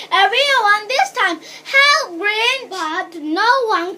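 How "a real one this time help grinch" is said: louder, higher cry for help; urgent yelling. A narrator's reading. Girl begging villagers to believe her alarm, yelling. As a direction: yell it urgently, insisting. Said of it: "she yelled".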